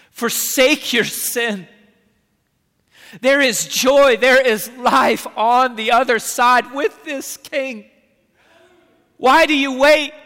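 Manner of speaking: loud, high pleading sermon; urgent altar call. Acted. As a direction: plead with the congregation to come.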